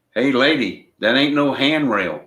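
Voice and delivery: loud, high goofy heckler yell; dopey cartoon bellow. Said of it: "a deep voice".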